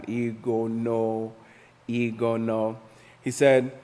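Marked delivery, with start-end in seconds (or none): 0.0-2.8 Igono drawn out slowly, syllable by syllable; name broken down. Shown as a demonstration. Teacher copying how Pastor breaks down Igono, slow syllables. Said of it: "breaks it down".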